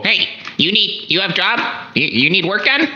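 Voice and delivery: pushy little robot-rider touting for work; heckling hawker. Acted. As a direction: call out to passersby for odd jobs. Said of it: "asking people".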